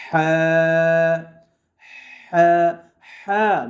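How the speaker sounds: "হা" from mid-throat, full of hot breath; "হা" as a strong Arabic h pushed from the throat; hot-air ha. Shown as a demonstration. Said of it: "গরম বাতাস বের হবে".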